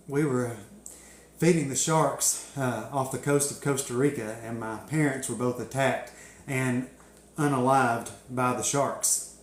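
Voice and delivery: low, halting, mock-solemn account; feigned grief. Telling news crew a fake shark tragedy, mock-solemn. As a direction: play it straight, like a painful memory.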